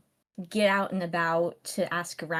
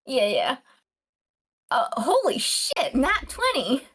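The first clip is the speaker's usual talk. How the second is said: high, loud, thrilled yell; real excited outburst; 4 semitones higher, 5 dB louder than usual. Real, not acted. Player reacting to a natural twenty, excited outburst. Player celebrates a lucky roll.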